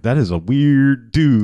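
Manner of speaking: slow, drawn-out retold verdict; mock bemusement. Skillet comments as Blackula leaves, slow mock bemusement. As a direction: stretch it out, playfully baffled.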